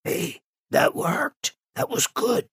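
slow, impressed whisper; hushed admiration. Acted. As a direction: whisper it to your companion. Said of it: "he whispers to him".

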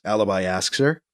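ordinary delivery

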